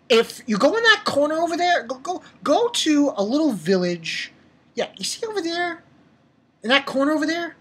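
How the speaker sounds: high-pitched, condescending directions; mock-helpful, high singsong; mock coaxing. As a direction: point them away in a high, patronizing voice. Mockingly sends character to a corner.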